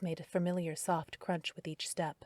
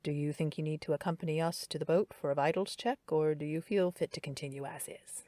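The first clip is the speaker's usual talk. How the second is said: low and calm. A narrator's reading. The doctor coaxing Darren toward a vitals check, low and calm. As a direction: soothe him gently. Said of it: "voice low and calm".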